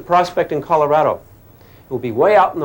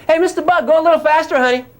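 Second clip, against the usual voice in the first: higher, sing-song coaxing call; coaxing the bug; 12 semitones higher than usual. Acted. Teacher voicing someone urging the bug, coaxing higher. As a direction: sweet-talk the bug into speeding up. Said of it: "Now you say to the bug".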